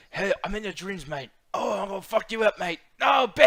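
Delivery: a hint of Statham menacing a victim; mock Statham British accent. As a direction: growl it like a Cockney hard man in your dreams. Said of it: "Imagine a regal voice like his too".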